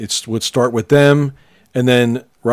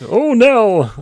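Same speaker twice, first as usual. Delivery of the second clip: much higher, dopey cartoon Mountie; high goofy hero voice; 12 semitones higher, 3 dB louder than usual. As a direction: call out like a dim heroic Mountie. Imitating Dudley Do-Right.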